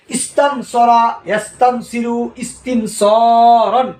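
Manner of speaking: slow, evenly spaced Arabic forms, each word drawn out; slow model recitation. Shown as a demonstration. Teacher modelling the verb forms, slow and deliberate. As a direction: recite slowly so students can follow. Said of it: "এভাবে পড়বেন".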